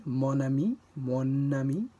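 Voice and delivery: Mon ami with the N carried onto ami; linked mon ami. Shown as a demonstration. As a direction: glide the N straight into ami. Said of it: "from mon links to ami".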